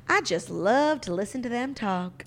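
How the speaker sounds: slow Southern drawl; imitating a Southern mom; Southern accent. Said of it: "she says with her thick Southern accent".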